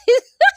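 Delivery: high, loud cracking laugh. Real, not acted. Laughs at own mispronunciation.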